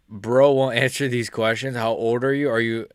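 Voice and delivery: normal talking voice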